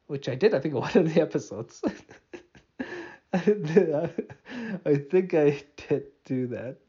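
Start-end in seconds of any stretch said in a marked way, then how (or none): 2.8-3.3 short breathy giggle; caught-out laugh; embarrassed laugh. Real, not acted. Laughs at admitting farting on air. 3.3-6.8 slow words through laughter; amused, dragged-out admission; laughing confession. Real, not acted. Confessing farting on air, laughing.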